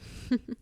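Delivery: quick breathy giggle; teasing chuckle. Acted. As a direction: chuckle lightly at the clumsy patient. Teasing the patient as a klutz.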